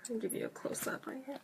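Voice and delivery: soft whisper into the camera. Real, not acted. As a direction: whisper it close to the lens. Leaning in for close-up.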